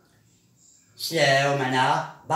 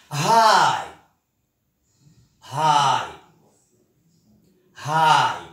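louder, called out; loud drill call; 5 dB louder, much slower than usual. Shown as a demonstration. Teacher calls out the new word to the class, loud. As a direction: announce the word to the room.

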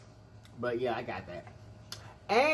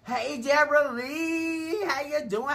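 sing-song greeting; 14 semitones higher, 7 dB louder, slower than usual. Real, not acted. Calling out greeting to viewer.

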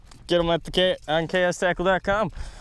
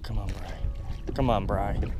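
quiet urging; 6 semitones lower, 6 dB quieter than usual. Real, not acted. Quietly urging a fish to bite.

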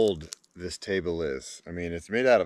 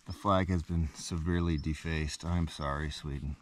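quiet apology; 3 semitones lower, 8 dB quieter than usual. Pretend. Quiet mock apology to flag.

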